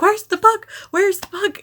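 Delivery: searching wildly for the puck; imitating a freaked-out broadcaster; frantic. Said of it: "Connor was freaking out".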